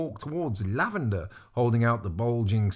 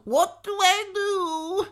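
very high, drawn-out cry; shouting; 4 dB louder, much slower than usual. A narrator's reading. Lavender asking what to do, high panicked shout. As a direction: wail the question, stretching it out. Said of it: "Lavender was shouting".

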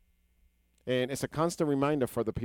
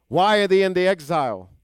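preaching question; 4 semitones higher, 7 dB louder than usual. Real, not acted. Preacher asks the congregation.

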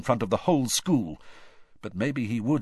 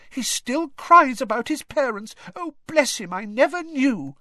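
much higher, fretting mum voice; anxious mother; 5 dB louder than usual. Retold or imitated. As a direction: brother mimicking a worried mum. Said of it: "imitated his mother's anxious voice".

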